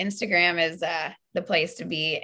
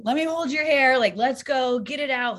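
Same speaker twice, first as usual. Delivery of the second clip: hyped supportive friend; 7 semitones higher than usual. Retold or imitated.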